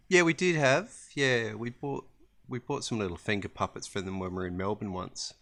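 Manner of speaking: ordinary talk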